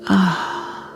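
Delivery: long, soft exhaled "ah"; gentle demonstrated sigh of release; relaxed sigh. Shown as a demonstration. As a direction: sigh it out softly, letting go. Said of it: "Feel your body sigh".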